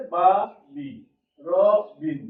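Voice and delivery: "বা", "বি", "র", "বিন" each a separate beat with pauses; syllables counted out. Shown as a demonstration. Teacher counting syllables for the workshop, one beat each. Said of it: "স্বরবৃত্তি কি হচ্ছে".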